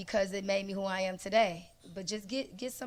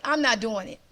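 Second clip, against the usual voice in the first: defiant refusal; 3 semitones higher, 7 dB louder than usual. Retold or imitated. Refusing the day before stage.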